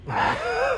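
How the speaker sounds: a short breathy laugh; quick airy giggle. Real, not acted. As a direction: laugh at the awkward moment. Laughing before an impression.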